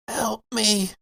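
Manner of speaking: high, faint plea through a door; eerie plea. Acted. Unseen thing behind the hatch begging, eerie thin plea. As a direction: whisper it like something trapped.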